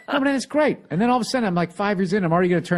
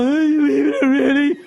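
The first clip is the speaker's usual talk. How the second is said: loud, high-pitched mock whine; whiny girlfriend imitation; 11 semitones higher, 7 dB louder than usual. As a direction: wail it as a mocking caricature of her. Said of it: "That's how she sounds when she says shit like that".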